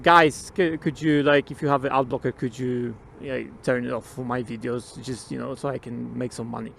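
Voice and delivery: soft, low plea to viewers; humble request. Acted. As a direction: ask a favour gently, voice sinking low. Polite plea of small channel.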